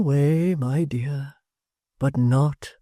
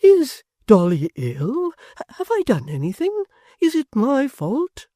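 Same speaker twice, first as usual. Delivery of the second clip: stammering; 11 semitones higher, 5 dB louder than usual. A narrator's reading. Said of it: "stammered out".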